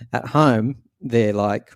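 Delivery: ordinary delivery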